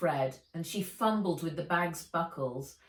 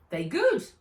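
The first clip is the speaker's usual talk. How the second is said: Altan, lightly voiced; 8 semitones higher, 7 dB louder than usual. A narrator's reading. Altan's broken English.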